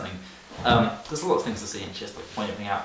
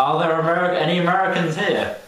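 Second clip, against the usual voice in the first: a touch higher, mock condescending question; 6 semitones higher, 3 dB louder than usual. Teen imitating the guide asking the tourists, condescending. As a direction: talk down to the crowd, lightly. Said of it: "she's so condescending".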